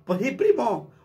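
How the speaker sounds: high squeaky reply; high jarocho mouse; Veracruz accent. Shown as a demonstration. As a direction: agree like another jarocho. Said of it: "Y el otro".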